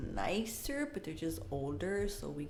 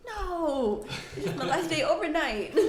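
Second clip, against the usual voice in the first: higher and louder, giggling it out; laughing reply; 9 semitones higher, 7 dB louder, slower than usual. Retold or imitated. [name] telling coworkers it's her last overnight, laughing. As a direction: laugh through the answer. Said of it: "I was like".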